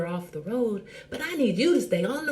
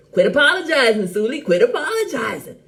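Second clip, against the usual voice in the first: loud laughing command; 4 semitones higher, 8 dB louder than usual. Real, not acted.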